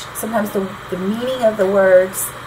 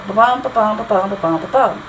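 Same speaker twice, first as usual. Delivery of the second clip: five even ba-BUMs in a run; iambic pentameter beat. Shown as a demonstration. Teacher showing the Shakespearean line, iambic beat. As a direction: beat out ba-bum, landing on every BUM. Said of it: "That's Shakespearean".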